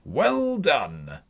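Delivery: loud, high cheer; a jolly host stopping the dance with applause; Fezziwig's cry. A narrator's reading. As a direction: praise the dancers with gusto. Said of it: "cried out".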